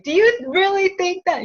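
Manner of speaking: playful you-can't-be-serious; mock disbelief. Medium voicing sister's playful challenge, high and loud. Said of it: "She comes back to that".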